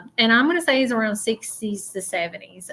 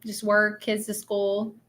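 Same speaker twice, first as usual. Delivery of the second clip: ordinary delivery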